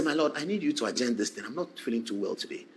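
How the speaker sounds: soft, weak, put-on poorly voice; feigned sick voice. Counsel asking the judge to adjourn, faking a weak sick voice. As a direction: play it up as sick to win an adjournment.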